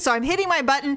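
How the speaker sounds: normal talking voice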